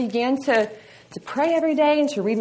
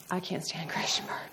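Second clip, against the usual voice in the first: whispered aside; 4 semitones lower, 11 dB quieter than usual. Real, not acted.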